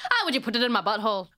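fast, hammed-up Irish lilt; mock Irish accent. Joking about wanting an Irish accent, hammed-up Irish. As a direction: ham up a bad Irish accent. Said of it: "wanna have an Irish accent".

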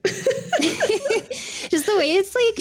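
words through a giggle; laughing. Real, not acted. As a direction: laugh while you speak. Laughing about a dreamy scene.

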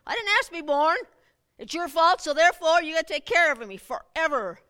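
put-on whiny entitled kid. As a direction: mimic a child blaming the parent.

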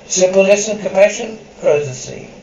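measured narrator summing up the lesson; closing narration. A narrator's reading. Narrator closing the episode: measured summing up.

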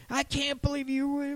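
mock shriek pitched very high; mock yell. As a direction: play an outraged partner screeching. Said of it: "So if someone's yelling, screaming".

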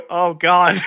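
loud, tearful laughter; laughing. Real, not acted. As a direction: laugh so hard it hurts. Laughing until almost crying.

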